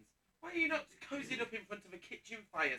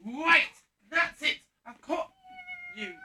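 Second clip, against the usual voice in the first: loud, higher triumphant cry; triumphant shout; 8 dB louder, slower than usual. Acted. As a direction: pounce on the thief with a shout. Pouncing on the thief.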